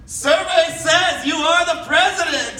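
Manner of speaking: high, loud, slow announcer shout; game show announcer. Retold or imitated. Announcing the president at the inauguration like a game show. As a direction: call it like a game show host.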